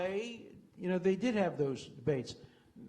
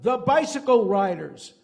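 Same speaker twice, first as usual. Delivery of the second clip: much louder blurted answer; scream from the crowd; 3 semitones higher, 10 dB louder than usual. Retold or imitated. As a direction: yell it out from the back. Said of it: "one guy screams out".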